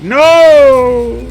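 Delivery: very high, drawn-out wail; loud cry of dismay. Real, not acted. Player interrupts himself at a bad play, loud drawn-out cry. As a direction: yell it long and high.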